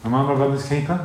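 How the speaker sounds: a light hint of a sulky teen brushing God off; mildly imitated, shrugging "whatever" Cain; stroppy teenager. Preacher playing Cain answering God like a stroppy teenager, lightly sulky. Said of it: "I can just hear stroppy teenager voice all over this".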